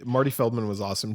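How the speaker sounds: usual speaking voice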